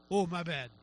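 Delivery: low, deflated mock apology; sheepish apology. Nature backing down when rebuked, sheepish.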